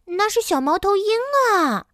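high, excited little bear voice; little cub's high exclamation; excited cry. A narrator's reading. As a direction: call out like a child spotting an animal. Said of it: "小熊叫起来".

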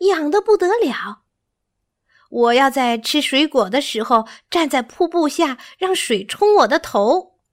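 put-on high, excited childlike voice; eager monkey, pitched well up; delighted little monkey. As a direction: chatter happily with bubbling enthusiasm.

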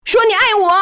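very high, loud command shout; shrill scream. A narrator's reading. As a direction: scream the order at him. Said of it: "他命令道".

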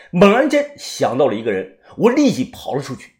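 usual speaking voice

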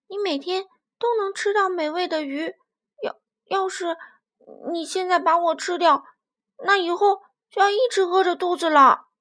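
high, loud, urgent little voice; pleading baby dinosaur. Acted. Baby spinosaurus bargaining with the dinosaur about to eat it, frantic pleading. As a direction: plead desperately, stumbling now and then.

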